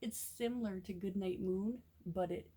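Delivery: usual speaking voice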